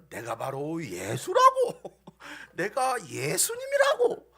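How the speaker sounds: drawn-out, insistent mimicry of a church brother. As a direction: act out his insistent claim. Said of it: "우리 홍태 형제가 예전에". (교회 형제를 흉내 내며 길게 늘여 우기듯)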